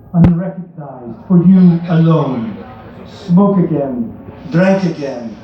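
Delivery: hammed-up, vaguely foreign Hollywood bad accent; poem read in an unplaceable fake accent. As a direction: recite it in a deliberately terrible accent. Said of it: "read it in a very bad accent".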